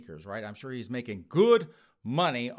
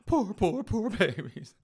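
higher, repeated sarcastic cooing; mock pity; 9 semitones higher, slower than usual. Host mocking offended islanders with repeated fake sympathy. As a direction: pat them on the head with fake pity.

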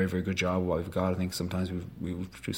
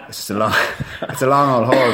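laughing through the words; 5 semitones higher, 10 dB louder than usual. Real, not acted. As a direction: laugh it out, loud and high. Laughs at own long answer.

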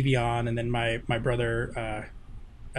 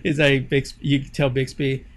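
ordinary talk

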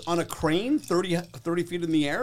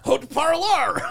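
high, loud French in an Anglo accent; heavy Anglo accent; 11 semitones higher, 5 dB louder, slower than usual. Shown as a demonstration. Comic repeating his commercial line, loud heavy Anglo accent. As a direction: belt out the French with English sounds. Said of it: "that's a heavy Anglo accent".

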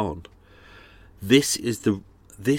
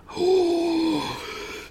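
sharp high gasp. Real, not acted. Sudden gasp, unworded.

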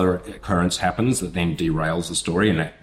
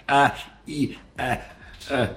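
awkward squirm; 7 semitones higher, 3 dB quieter than usual. Retold or imitated. Caught with last pink cast.

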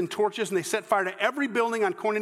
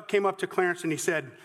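usual speaking voice

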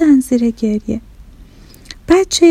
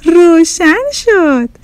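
high, drawn-out joyful cheer; happy exclamation; 6 semitones higher, 4 dB louder, much slower than usual. A narrator's reading. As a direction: cheer slowly and high, delighted. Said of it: "هر دو با خوشحالی گفتن". (با خوشحالی و کشیده)

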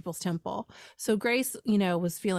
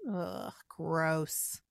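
annoyed ugh; 4 semitones lower, 5 dB quieter than usual. Real, not acted. Disgust at the cult leader.

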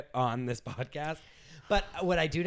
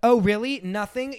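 high, loud sarcastic scoff; sarcastic disbelief; 9 semitones higher, 5 dB louder than usual. Acted. Lauren scoffing at Heidi's denial, high sarcasm. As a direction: scoff at her.

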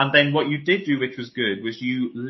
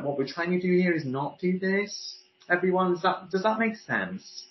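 higher, "this" and "that" lifted as if asking; questioning upspeak; 6 semitones higher than usual. Shown as a demonstration. Voice coach mimicking managers' speeches, questioning upspeak. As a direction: make each phrase seek agreement. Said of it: "the end of their phrases turns into a question".

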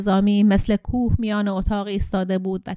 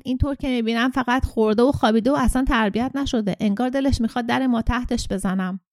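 ordinary delivery

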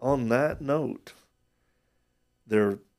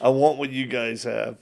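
louder on "want", an eager outburst; eager listener; 2 semitones higher, 9 dB louder than usual. Retold or imitated. Host voicing a viewer who wants this faith, eager and louder. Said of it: "people listening and watching right now going".

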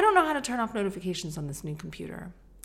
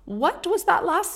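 much higher, louder, sing-song excuse me; overly polite mom; 7 dB louder than usual. Retold or imitated. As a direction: call sweetly, slow at the end, to the boys. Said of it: "It was actually so pathetic".

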